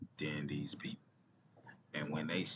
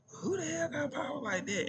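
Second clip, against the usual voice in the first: incredulous; 4 dB louder than usual. Real, not acted. Indignant rhetorical question.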